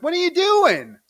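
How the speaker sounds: put-on high, loud baby squawk; mock baby outcry. Baby catches Charlene at the wallet, mock outcry. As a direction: blurt it high and stretched, as the startled baby.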